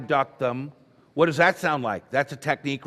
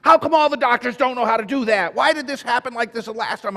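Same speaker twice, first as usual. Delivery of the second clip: high, loud mock yelling of an upset mother; loud, high imitation of a mother's complaints; imitating angry yell; 8 semitones higher, 5 dB louder than usual. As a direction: act out her shouting at the doctor. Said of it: "She started yelling at me again".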